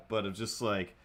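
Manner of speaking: usual speaking voice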